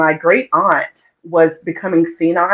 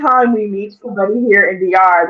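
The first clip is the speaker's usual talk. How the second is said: ordinary talk, nothing marked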